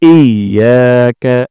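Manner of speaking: "ইয়াকা" with ইয়া held long; very slow, stretch the ইয়া; lengthened ya. Shown as a demonstration. Teacher showing the long ইয়া. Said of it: "ইয়াতে টান দিব".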